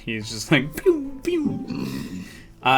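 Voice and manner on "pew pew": pew pew. Retold or imitated. Imitating blaster shots.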